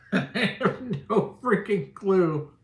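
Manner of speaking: high, slow, laughing through the words; laughing disbelief. Real, not acted. Streamer baffled by a chat question, laughing high and slow. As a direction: giggle out the confusion.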